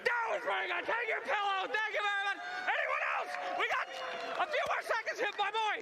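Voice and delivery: high, excited crowd host; hype host. Real, not acted. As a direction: keep the energy up.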